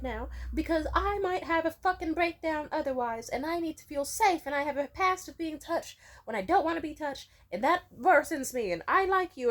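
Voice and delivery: high, loud, frantic yelled defense; retold yelling back. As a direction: replay shouting back at him, near breaking point. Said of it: "And I was like".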